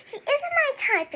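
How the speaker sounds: high mock-innocent, laughing; mocking imitation. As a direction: parrot her line, laughing. Mocking friend's act.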